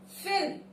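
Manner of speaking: no vowel between L and M in "Film"; correct pronunciation. Shown as a demonstration. Teacher modelling the word, correctly pronounced. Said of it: "correct pronunciation film".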